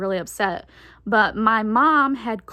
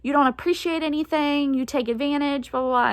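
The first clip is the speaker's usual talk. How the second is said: higher, faster scolding in mom's voice; mock a scolding mother; imitating upset mom; 6 semitones higher than usual. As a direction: daughter imitating her upset mom's lecture. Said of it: "she was rightfully upset".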